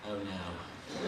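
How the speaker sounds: low, hushed, half-laughing oh no; dreading groan. Retold or imitated. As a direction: mutter it with a rueful chuckle. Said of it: "I'm like".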